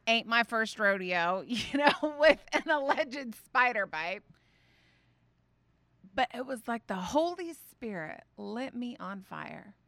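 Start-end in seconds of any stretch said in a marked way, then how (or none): none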